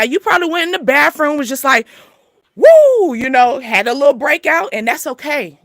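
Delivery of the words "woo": loud, high mock "woo" of release; exhale yelp. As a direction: blow off steam with a sharp high woo. Breakout moment in the bathroom.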